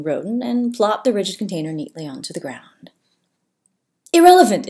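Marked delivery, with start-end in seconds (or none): 4.1-4.7 proclaiming. A narrator's reading. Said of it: "Ezekiel proclaimed".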